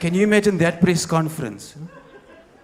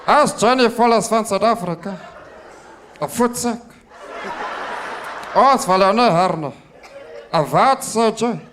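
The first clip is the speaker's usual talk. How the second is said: higher, laughing Afrikaans ranting; Afrikaans accent; 6 semitones higher than usual. Acted. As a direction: tell them off in thick Afrikaans. Acted Afrikaans rant.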